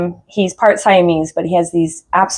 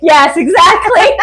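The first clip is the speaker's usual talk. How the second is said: ordinary talk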